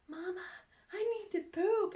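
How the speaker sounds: put-on high, pleading little-kid voice; child's voice. Four-year-old son telling mom he needs to go, high little voice. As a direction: imitate her four-year-old begging. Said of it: "he looks up at me and he says".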